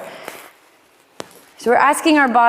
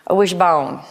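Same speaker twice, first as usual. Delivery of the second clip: Southern accent; 2 semitones lower than usual. Shown as a demonstration. Yoga teacher jokingly repeats the cue in a playful Southern accent. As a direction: say "A wishbone" like a Southern belle would. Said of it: "if you say it in a Southern accent".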